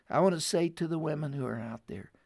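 rally the women like a preacher; preaching exhortation. Acted. Urgent exhortation to women listeners.

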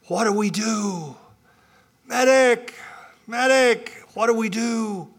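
high panicked cries for a medic; man down shout. Retold or imitated. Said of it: "there were screams up and down the beach".

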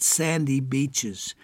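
normal talking voice

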